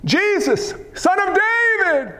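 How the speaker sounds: high, drawn-out cry for attention. Acted. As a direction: call it out high like a blind beggar. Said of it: "What did he cry out?".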